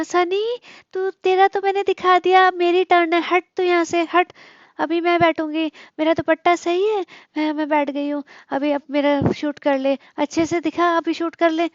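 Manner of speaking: high, put-on voice mimicking a pushy girl demanding her turn; mock bossy vlogger. Mocking the vlogger ordering Sunny to film her, high mock voice. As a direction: ham up a vain girl shoving her husband aside.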